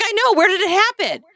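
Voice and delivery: very high, desperate mock insistence; panicking. Teen insists to sister, very high mock panic. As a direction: squeak it out, pitch soaring. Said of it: "and I'm just panicking".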